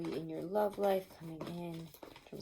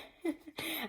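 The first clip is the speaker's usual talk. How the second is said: light giggle after teasing; soft laugh; 7 semitones higher, 6 dB quieter than usual. Real, not acted. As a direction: giggle softly.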